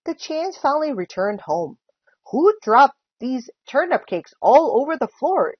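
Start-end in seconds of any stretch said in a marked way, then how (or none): 2.3-5.6 louder, higher, punched words; storybook outrage; indignant exclamation. A narrator's reading. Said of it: "exclaimed Mister Chan".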